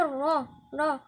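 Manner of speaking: الرا with a thick, dark r; backed vowel after the r in را; heavy Raa. Shown as a demonstration. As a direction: draw out a full emphatic را. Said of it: "راء مفخمة".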